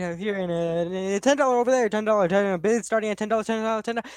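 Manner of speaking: fast, loud auctioneer patter. Shown as a demonstration. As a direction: rattle off bids like an auctioneer. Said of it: "I don't think I can pull off the auctioneer voice".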